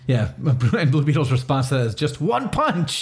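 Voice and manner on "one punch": high, gleeful burst; gleeful yelp, much higher; excited. Acted. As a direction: crow it in delight. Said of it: "He's so excited".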